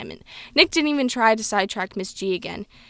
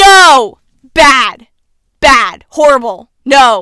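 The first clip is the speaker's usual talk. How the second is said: shouted disapproval, word by word; emphatic, much louder outbursts; loud scolding; 2 semitones higher, 15 dB louder, much slower than usual. Real, not acted. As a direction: scold loudly, like correcting a pet. Scolds the book's character loudly.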